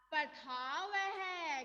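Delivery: पठाव है said slowly, syllables apart; slow model reading. Shown as a demonstration. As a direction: read पठाव है out slowly for the pupils. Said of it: "बोलो बेटा".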